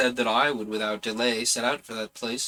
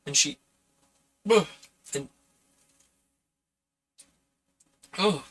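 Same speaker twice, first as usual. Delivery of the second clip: hiccups; 3 semitones higher than usual. Real, not acted. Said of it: "I'm having the worst hiccups".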